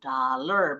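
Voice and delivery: DOLLAR as English "da-ler", first vowel open a; pelafalan benar. Shown as a demonstration. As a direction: say DOLLAR with "da", not "do". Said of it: "Bacanya bukan dolar". (DOLLAR dibaca "da-ler")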